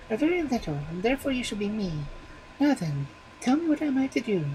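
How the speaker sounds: put-on very high baby voice; a child naming his bones. A narrator's reading. Baby naming the magic bones, very high.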